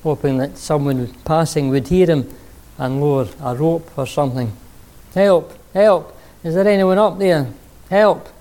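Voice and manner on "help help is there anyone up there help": high, loud calls down from a cliff; acted cries of a man hanging from a branch; calling for help. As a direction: yell for help as if stranded on a ledge. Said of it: "Jack began yelling for help".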